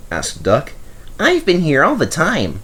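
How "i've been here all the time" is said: smiling small engine. A narrator's reading. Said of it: "smiled the small engine".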